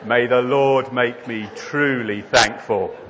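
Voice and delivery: loud, solemn mock prayer; slow, ceremonious punchline delivery; bear saying grace. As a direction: intone grace before a meal, building to Amen. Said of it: "bowed his head".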